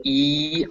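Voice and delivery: "E" high, soft and tense. Shown as a demonstration. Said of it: "this one as being very tense".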